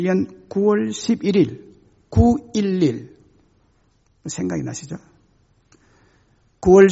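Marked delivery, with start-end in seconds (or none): none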